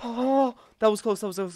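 high, loud, frantic reaction; worked-up near miss. Real, not acted. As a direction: blurt it out after a near miss. Narrow miss in the game.

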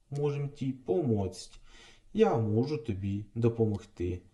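ordinary talk, nothing marked

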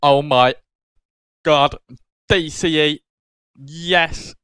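loud, excited outburst at a lucky pull; real celebratory yell; thrilled shout. Real, not acted. Thrilled at pulling wanted card.

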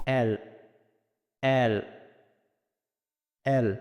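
এল said alone, open vowel and held l; careful isolated repetitions of এল; correct letter L. Shown as a demonstration. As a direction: model এল slowly, one at a time. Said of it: "এল এর সঠিক উচ্চারণ হবে".

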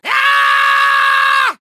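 high, long villain scream; frustrated scream. Acted. Villain unable to find the helm, long scream. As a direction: scream in exasperation. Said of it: "I am going to scream".

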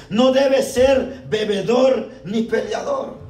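"No debe ser bebedor ni peleador" read slowly, every word weighed; slow stressed reading. Shown as a demonstration. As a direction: lay out each word of the rule slowly.

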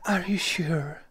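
unsure whisper. Acted. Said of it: "Sam seems unsure".

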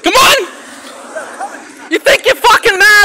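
high-pitched real furious yell; angry challenge. Real, not acted. Man confronting rock thrower after the car attack, angry shouting. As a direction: shout them down, daring them.

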